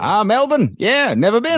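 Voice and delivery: high, swooping put-on Aussie; mock Australian accent. Co-host reacting to the city name, high mock Australian accent.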